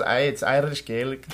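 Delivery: put-on Irish lilt; Irish accent. Repeating for co-host, put-on Irish accent. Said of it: "What'd you say?".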